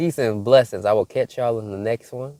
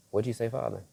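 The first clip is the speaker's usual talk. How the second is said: softer, hushed question to God; quiet aside; 8 dB quieter, faster than usual. Real, not acted. Speaker breaks off the message to ask Father God, quiet aside. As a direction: drop the volume for an aside upward.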